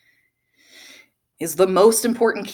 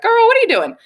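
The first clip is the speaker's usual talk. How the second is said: high, loud sassy mom scolding; sassy lady voice; 7 dB louder than usual. Retold or imitated. The inner voice scolds her in the woods, high sassy mom. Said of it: "such a sassy lady vo".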